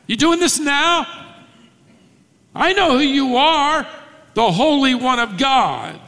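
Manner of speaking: high, alarmed challenge; demon paraphrase. Shown as a demonstration. Demon realising Jesus will act now, high and alarmed. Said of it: "In other words, are you gonna do it now?".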